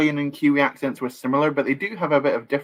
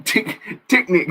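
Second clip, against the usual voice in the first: put-on TICKNICK with short "i" vowels, New Zealand accent, amused; Omega's New Zealand accent; 8 semitones higher than usual. Streamer reading a chat joke, TICKNICK in a playful New Zealand accent. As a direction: say TICKNICK in a laughing mock Kiwi accent. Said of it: "that's how, um, Omega ... would pronounce".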